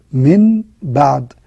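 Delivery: very slow "من بعد" with the nun turned to meem; iqlab demo. Shown as a demonstration. Teacher modelling the rule for students, slowly and clearly. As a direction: draw out "من بعد" with a held nasal hum. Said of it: "بعدها حرف الباء".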